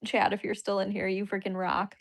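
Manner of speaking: ordinary talk, nothing marked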